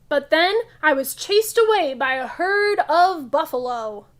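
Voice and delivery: loud, lively picture-book read-aloud; reading to children. Acted. Teacher reading a funny museum story to kids, loud lively read-aloud. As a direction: read it big and bright for the class.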